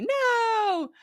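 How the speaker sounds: put-on high, small childlike no; a little kid's amused no; giggling child. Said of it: "your child would giggle and say".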